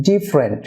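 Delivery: "ডিফারেন্ট" in two syllables, DIF-rent; correct pronunciation. Shown as a demonstration. Teacher models for viewers, "ডিফারেন্ট" as DIF-rent. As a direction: drop the middle vowel of "ডিফারেন্ট". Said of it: "remove হয়ে থাকে".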